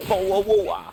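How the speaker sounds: high mock-British 'bottle of water'; exaggerated English accent with glottal stops; bad English accent. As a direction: put on a silly, high-pitched British accent. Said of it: "a shit English accent".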